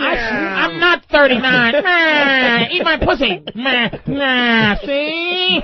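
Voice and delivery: mocking old-movie gangster voice with drawn-out bleats; Edward G. Robinson. Host teasing a woman about her voice, gangster caricature. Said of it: "Robinson voice with titties".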